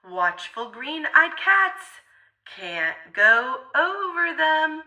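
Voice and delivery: plain reading voice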